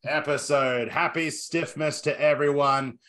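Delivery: drawn-out, announcer-style host introductions; showman intro. Acted. Podcast host introducing co-hosts like a wrestling ring announcer.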